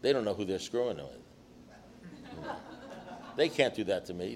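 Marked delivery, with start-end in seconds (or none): 0.0-2.5 put-on slow, soft, half-laughing mutter of a self-pitying drunk; imitating a drunk's paranoid grumble; mock alcoholic grumble. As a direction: drag it out like a resentful drunk who thinks he's untouchable. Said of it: "They say".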